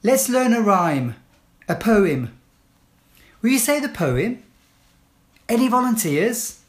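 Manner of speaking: firm, clear classroom instructions; teacher giving commands. Shown as a demonstration. Teacher to pupils entering class, firm clear commands.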